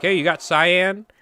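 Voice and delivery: imagined customer. Acted. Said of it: "Somebody was like".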